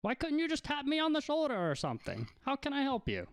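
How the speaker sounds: put-on high-pitched, flustered old man; jump, then scold in a high old voice; high old shopkeeper voice, taken by surprise; startled old man. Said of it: "He jumps and he goes".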